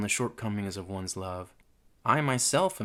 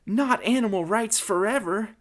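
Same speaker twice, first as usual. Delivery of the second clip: dismayed exclamation; 11 semitones higher than usual. Acted. Mary Ann's alarmed exclamation continues.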